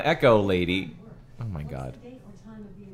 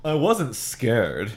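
bravado; much slower than usual. Acted. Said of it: "suddenly changing his tune".

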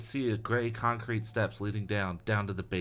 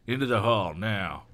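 mild bark; 4 dB louder than usual. A narrator's reading. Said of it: "he barked".

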